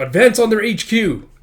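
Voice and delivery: loud, high shouted order; battlefield command. Acted.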